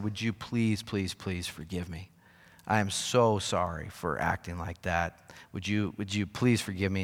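low, hushed retold begging; humble plea. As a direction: plead softly for forgiveness, voice dropped. Retells begging the attendants' forgiveness.

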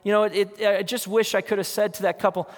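ordinary talk

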